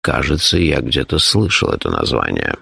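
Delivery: low voice, mildly uncertain; understated doubt in a deep character voice; unsure. A narrator's reading. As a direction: grope for a half-remembered word. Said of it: "неуверенно сказал Шурф".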